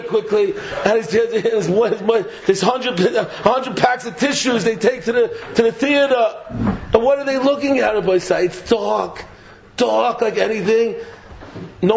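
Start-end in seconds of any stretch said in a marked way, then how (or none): none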